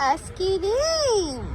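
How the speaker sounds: Ice cream stretched out slowly, syllable by syllable; slow drawn-out word for a toddler. Shown as a demonstration. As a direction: draw out ice cream slowly so the child can copy it. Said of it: "কিরকম করে বলো ফাতিয়া".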